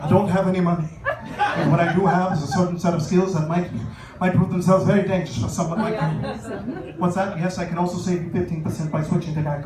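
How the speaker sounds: a hint of Liam Neeson's calm threat; Liam Neeson impression. Comic on a hostage call, a low lightly done Liam Neeson. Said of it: "Liam Neeson answer the phones".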